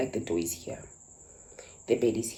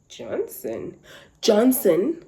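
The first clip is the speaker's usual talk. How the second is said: calling out; 7 semitones higher, 6 dB louder, much slower than usual. Retold or imitated. Woman calling through the door.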